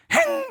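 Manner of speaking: sharp, very high huffy "행!"; dog's huff. Retold or imitated. Dog storming off after a scolding, huffy high snort. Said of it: "이러고 갑니다".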